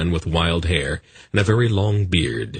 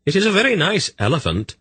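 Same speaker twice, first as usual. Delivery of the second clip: higher, fast mock-friendly call; calling to a hidden trickster, higher and fast; 13 semitones higher, faster than usual. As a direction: call out into the trees teasingly. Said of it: "she called into the woods".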